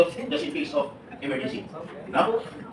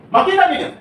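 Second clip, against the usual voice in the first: excited callout; 13 semitones higher, 9 dB louder than usual. Real, not acted. Calling out to the audience.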